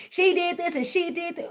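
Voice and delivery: high, sneering mimicry of gossip; mocking quote. Mocking what others say.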